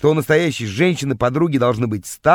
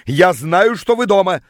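raised, higher-pitched yell; 5 semitones higher, 4 dB louder than usual. A narrator's reading. Rival outside accuses those hiding inside, yelling. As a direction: call out loudly through the door. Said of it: "стал орать он под дверью".

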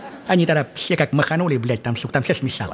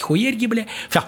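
usual speaking voice

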